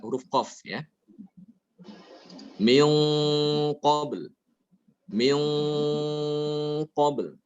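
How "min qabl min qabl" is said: long dark nasal hum on "Min" into "qabl"; thick ghunnah. Shown as a demonstration. Teacher shows the hum before qaf, heavy nasal. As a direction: hold "Min" thickly through the nose. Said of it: "Perhatikan ya, dengungnya".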